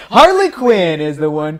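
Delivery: loud, drawn-out winner announcement; excited reveal. Real, not acted. Host revealing the last panel to viewers, excited reveal. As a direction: announce it big, stretching the words.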